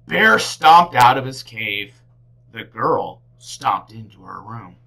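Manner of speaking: usual speaking voice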